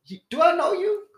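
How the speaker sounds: very high, loud, confused query; bewildered question. Retold or imitated. Asking the stranger if they know each other, bewildered. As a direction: act out total non-recognition. Acting puzzled reply to stranger.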